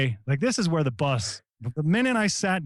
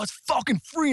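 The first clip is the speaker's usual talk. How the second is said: defiant retort; 10 semitones higher than usual. Retold or imitated. Overruling the pool boy.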